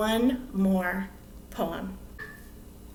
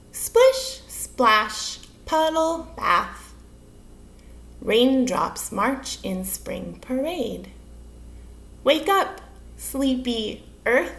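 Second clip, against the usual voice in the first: measured, playful haiku reading; poem recitation; slower than usual. Acted. Teacher reading a rain haiku to children, deliberate expressive recitation. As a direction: read each word with space round it.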